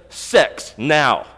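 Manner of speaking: loud, in-your-face order; two words punched out as a blunt demand; emphatic command. Acted. Potiphar's wife demanding Joseph come to bed, emphatic command. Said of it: "these two words of emphatic command would be sort of like".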